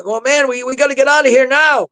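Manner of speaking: high-pitched imitation of a frantic husband; panicked urgency. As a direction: act out desperate insistence on fleeing. Frightened husband insisting they flee.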